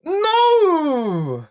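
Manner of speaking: long high wail of no; anguished no. Real, not acted. Fan crying out at the news, high wail. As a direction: cry out in disbelief.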